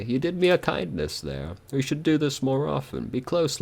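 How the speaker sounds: the corpse longing for closeness; tender corpse. Acted.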